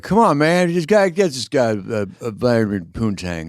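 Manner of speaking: high, loud Biden yell; Biden impression. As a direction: shout it like Joe Biden. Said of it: "did you do the whisper yell?".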